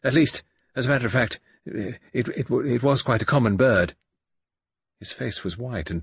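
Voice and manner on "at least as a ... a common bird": stammering, halting repeats; hesitating. Acted. Norton covers up what he saw, halting and stammering. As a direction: trip over the first words nervously. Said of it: "in a curious, hesitating voice".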